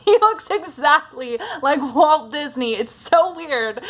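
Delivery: real tickled laughter in the voice; amused laughing. Real, not acted. Amused by the resemblance.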